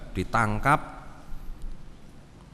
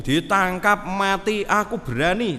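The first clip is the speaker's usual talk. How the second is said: boastful brag; 8 semitones higher, 5 dB louder than usual. Retold or imitated. Disciples bragging they fear no arrest, loud. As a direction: puff up and boast about being brave. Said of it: "Ndak ngomong kayak begitu".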